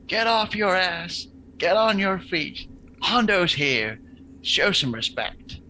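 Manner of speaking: slow, high barked orders; a hint of a swaggering cop, drawn out; tough cop act. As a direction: lay down the law like a hardass cop. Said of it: "full Hondo".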